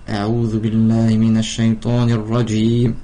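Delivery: fast murmured religious formula, in one breath; تلاوة سريعة; quick recitation. Acted. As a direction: rattle off the ta'awwudh quickly, devout. Recites the ta'awwudh.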